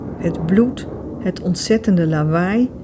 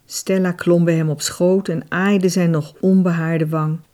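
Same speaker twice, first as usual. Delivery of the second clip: plain reading voice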